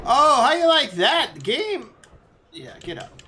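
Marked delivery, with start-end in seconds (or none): 0.0-1.9 high, slow, gloating shout; loud taunt. Real, not acted. Player hitting back at the game, loud taunt. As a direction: crow it at the game, drawn out.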